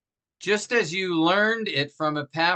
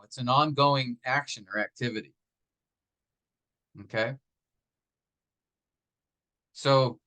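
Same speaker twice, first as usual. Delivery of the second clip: usual speaking voice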